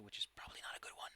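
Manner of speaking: unvoiced, quick whisper; whispered aside.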